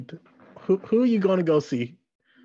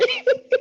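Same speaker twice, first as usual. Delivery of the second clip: high laugh. Real, not acted. Laughing hard.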